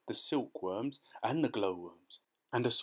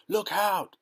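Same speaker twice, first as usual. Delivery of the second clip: loud, high warning shout; alarmed cry; 10 semitones higher, 9 dB louder than usual. A narrator's reading. Firemen and police see a monster, loud alarmed shout. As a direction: yell a sudden warning to everyone. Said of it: "they cried".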